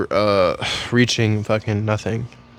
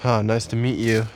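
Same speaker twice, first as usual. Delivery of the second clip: ordinary talk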